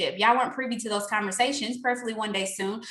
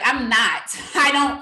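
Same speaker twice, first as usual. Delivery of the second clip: ordinary talk, nothing marked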